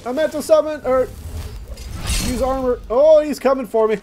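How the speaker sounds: high, loud, fast alarm; panicked. Real, not acted. Gamer fleeing an orc he provoked by mistake, panicky. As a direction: blurt it out in a fluster.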